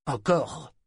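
croassement rauque et assoiffé; voix croassante. A narrator's reading. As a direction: croasser le mot, gorge sèche. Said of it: "croassa-t-il". (voix éraillée qui croasse)

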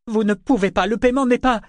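high, loud objection; frantic protest. A narrator's reading. Kefria cuts in, protesting the abduction. (protestation affolée)

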